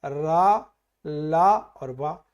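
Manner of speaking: teacher listing letters slowly; slow recitation. Shown as a demonstration. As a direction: draw out ला and वा very slowly. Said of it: "अगर आप देखोगे तो".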